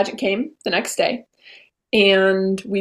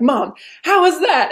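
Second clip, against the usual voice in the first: higher, slow, eager mom how was that; eager asking; 7 semitones higher, 3 dB louder than usual. Retold or imitated. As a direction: draw it out eagerly, voice up. Asking mom after talent.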